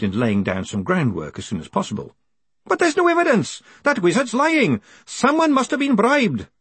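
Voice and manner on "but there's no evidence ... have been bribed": very high, urgent plea; high-pitched insistent protest; desperate pleading. A narrator's reading. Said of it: "Greenham pleaded".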